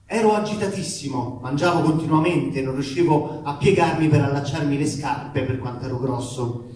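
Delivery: usual speaking voice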